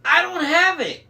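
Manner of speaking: very high, loud protest; frantic shout. A narrator's reading. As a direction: shout back in a panic. Frantic protest during the chaos.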